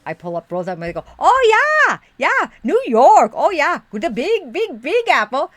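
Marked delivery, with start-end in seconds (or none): 1.2-5.5 loud, high, hammed-up Minnesota twang; big booming Minnesota accent act. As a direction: gush like an over-the-top Minnesota tourist. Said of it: "I did use that, um, accent".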